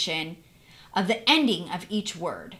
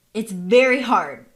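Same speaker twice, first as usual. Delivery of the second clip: ordinary delivery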